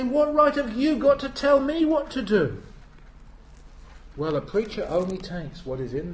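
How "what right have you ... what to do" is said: high, loud indignant challenge; uppity challenge. Retold or imitated. Churchgoer challenging the preacher, high and indignant. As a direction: push back at the preacher. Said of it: "uppity with preachers".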